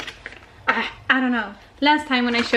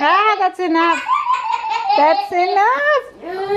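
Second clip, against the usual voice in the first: laughing; 9 semitones higher, 3 dB louder, much slower than usual. Real, not acted. Laughing with baby.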